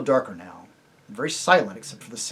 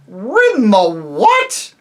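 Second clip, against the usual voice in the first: loud, slow, scornful high disbelief; contemptuous horse shout; contempt; 8 semitones higher, 11 dB louder, much slower than usual. A narrator's reading. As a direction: sneer it in disgust. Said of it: "retorted the horse with extreme contempt".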